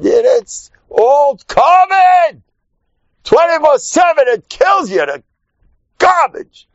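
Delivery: high shouted peak of the sermon. Real, not acted. Preacher shouting to the crowd about gossip. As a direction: cry it out at full force.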